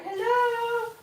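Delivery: high, drawn-out kitten greeting; crying kittens' hello. Retold or imitated. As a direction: imitate kittens calling out, high and long. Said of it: "They're like".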